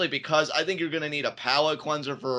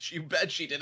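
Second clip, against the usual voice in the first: ordinary delivery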